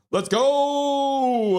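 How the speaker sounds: high, stretched-out let's go; hype call. Real, not acted. Celebrating the night's first donation with a high, drawn-out cheer. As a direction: celebrate it big and long.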